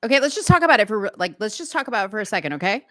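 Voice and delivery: [name] imitated as a Valley girl. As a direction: imitate a Valley girl rushing through okay. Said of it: "when [name] talks like a Valley girl".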